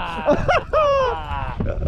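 squealing high-pitched laugh; giddy high laugh. Real, not acted. Fisher laughs at himself, high squeal. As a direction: let out a shrieking giggle.

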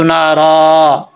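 नारा ending on a long held open aa, no n; pausal reading. Shown as a demonstration. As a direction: stop on नारा with the last vowel drawn out. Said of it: "अगर यहाँ पे वक्फ़ करेंगे". (नारा पर वक्फ़, अलिफ खींचकर)

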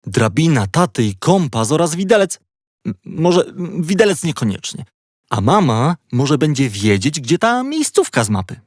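ordinary delivery, nothing marked

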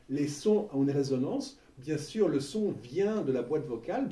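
ordinary talk

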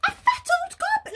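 high, outraged question; indignant. Acted. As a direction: squeal it in disbelief. Lion indignant at insult.

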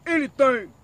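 loud emphatic repeat; yelling. Real, not acted. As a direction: shout the word Anything. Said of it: "Why are you yelling?".

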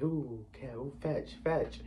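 soft, hushed mock dog game; murmured play-acting of fetch; playing doggy. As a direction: quietly imitate someone playing fetch. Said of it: "trying to play, like, doggy".

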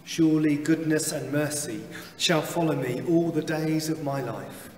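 ordinary talk, nothing marked

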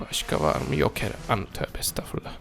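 hushed whisper while hiding. Real, not acted. Hiding from the monster, he whispers to himself. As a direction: whisper so the monster won't hear.

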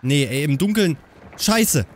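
ordinary talk

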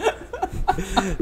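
high-pitched laughing fit; high burst of laughter. Real, not acted. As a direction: crack up laughing at the story. Bursts out laughing at own story.

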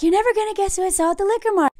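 high, quick put-on voice; imitated chirpy small talk; mock breezy wife. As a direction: rattle it off high and light, imitating her. Wife acted as falsely breezy.

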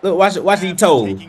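ordinary delivery

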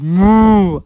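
big drawn-out moo; cow's moo. A narrator's reading. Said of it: "the cow said".

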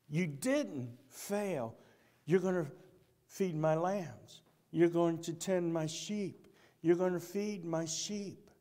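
soft and slow comfort; reassuring. Real, not acted. Preacher reassuring the listeners that they did not fail. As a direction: ease their guilt gently.

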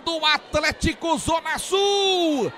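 long stretched goal scream; explosive goal commentary. Acted. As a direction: roar the goal call, drawing out gol. Narrator calls a goal.

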